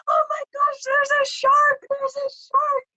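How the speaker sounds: high, squeaky imitation of a panicked husband; mock shark panic. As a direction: shriek it like he just saw a fin. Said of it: "he's like".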